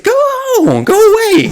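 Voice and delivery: high, loud shooing squeal; mock shoo. Shooing the lady out of the photo, high and loud. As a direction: shoo her off in a high shriek.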